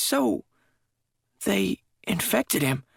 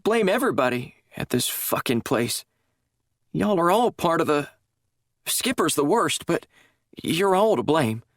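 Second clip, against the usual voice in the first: bitter; 8 semitones higher than usual. A narrator's reading. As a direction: spit the blame at them all. Sandy bitter at staff.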